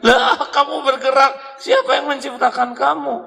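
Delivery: amazed question. Retold or imitated. A museum visitor asking a moving statue who made it. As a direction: gape at a moving figure in wonder.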